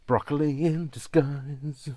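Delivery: loud, high, slow dramatic reveal; exclaimed. Acted. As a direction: boom it like a movie trailer. Punchline after Transformers joke.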